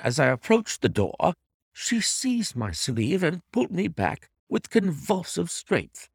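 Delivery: ordinary delivery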